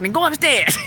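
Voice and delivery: high-pitched imitation of an upset grandma, breaking into a laugh; mock-upset grandma voice. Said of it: "when my grandma would get upset".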